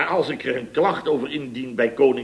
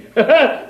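loud cackle; 13 semitones higher, 6 dB louder than usual. Real, not acted. Laughing at his own story.

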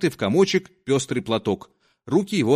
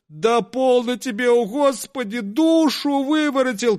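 crowd crying out; 10 semitones higher than usual. Acted. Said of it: "кричали кругом".

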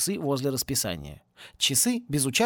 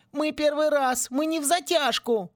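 much higher whiny plea; whining; 12 semitones higher than usual. A narrator's reading. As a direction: whimper it like a caught kid. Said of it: "заскулил Винки". (скулит, как пойманный мальчишка)

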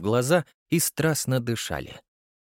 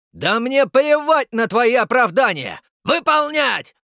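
very high, loud yelled order; furious shout; 12 semitones higher, 7 dB louder than usual. Acted. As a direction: cut him off with a roar. Граф обрывает генерала. (кричит приказ)

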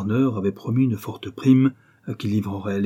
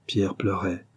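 plain reading voice

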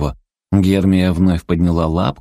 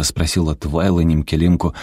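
usual speaking voice

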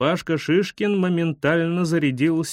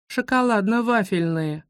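put-on higher-pitched girl chiming in; little schoolgirl; 5 semitones higher than usual. A narrator's reading. Schoolgirl adds candy detail, girlish chime-in. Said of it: "добавила Вера Козлова".

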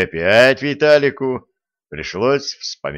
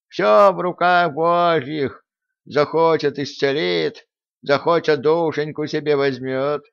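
pious priest voice; 6 semitones higher than usual. Retold or imitated. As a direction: mimic a sanctimonious priest. Voicing priests' pious talk.

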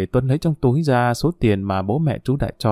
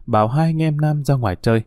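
plain reading voice

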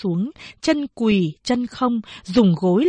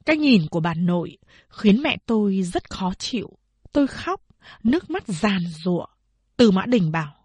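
plain reading voice

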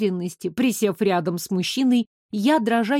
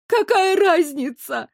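high, slowed tearful protest; sobbing; 7 semitones higher, slower than usual. A narrator's reading. Stuck girl answers Elisa, sobbing. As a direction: whimper it out, higher and drawn out. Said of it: "всхлипнула я".

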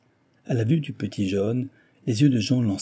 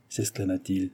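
ordinary delivery

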